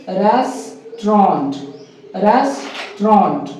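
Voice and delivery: रेस्टोरेंट slowly split, RES... TRONT; two slow beats for रेस्टोरेंट, "res, tront"; corrected pronunciation. Shown as a demonstration. Teacher drilling it slowly for students, corrected. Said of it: "क्या बोलेंगे".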